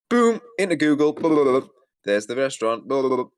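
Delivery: quick and loud, acting out the search; excited. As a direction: rattle it off with excitement.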